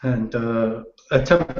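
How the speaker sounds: normal talking voice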